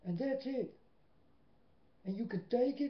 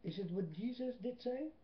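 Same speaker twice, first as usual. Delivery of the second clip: ordinary talk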